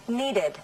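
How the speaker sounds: slow, needed as NEE-ded, the extra syllable clear; two syllables. Shown as a demonstration. Teacher shows students the extra syllable. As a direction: make the -ed in needed its own syllable. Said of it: "you must have another syllable".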